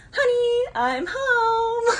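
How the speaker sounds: high, loud sing-song "Honey, I'm home"; the nervous system greeting chaos like a spouse coming home; sitcom homecoming call. Retold or imitated. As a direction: call it out like a cheerful spouse back from work. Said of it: "your nervous system is like".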